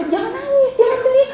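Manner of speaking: very high, pushy mimicry; mock ordering. Teacher mimics ordering a friend not to cry, mock ordering. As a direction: pitch it way up as a hammed-up command. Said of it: "Tapi kalau disuruh".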